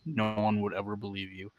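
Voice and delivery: soft, deadpan, conspiratorial; quiet deadpan tease. Retold or imitated. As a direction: lean in and murmur it. Bill Murray stealing fries line.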